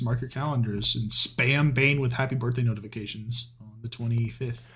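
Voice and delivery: ordinary delivery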